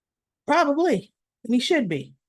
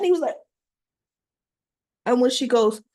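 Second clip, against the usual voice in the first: usual speaking voice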